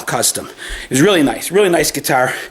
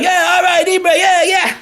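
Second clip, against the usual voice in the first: crowd cheering; 8 semitones higher than usual. Retold or imitated. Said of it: "Everybody's like".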